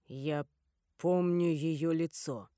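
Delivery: low, slow and flat; dry. A narrator's reading. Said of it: "произнёс голубоглазый настолько сухо". (сухо и медленно)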